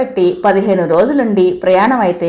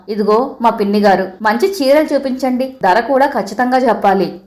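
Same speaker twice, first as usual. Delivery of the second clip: usual speaking voice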